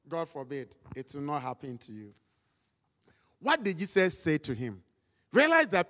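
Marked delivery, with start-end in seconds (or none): 0.1-2.1 mock aside. Shown as a demonstration. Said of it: "There is a way they do".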